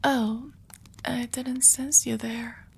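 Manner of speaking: low, slow hush after a start; hushed flustered ASMR whisper; startled whisper. Acted. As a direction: whisper as if caught off guard.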